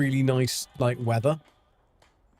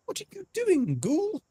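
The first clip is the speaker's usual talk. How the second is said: much higher squeaky mock voice; squeaky teasing voice; 14 semitones higher than usual. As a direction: tease the ghoul in a silly high squeak. Teasing a game character.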